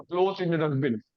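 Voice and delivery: ordinary talk, nothing marked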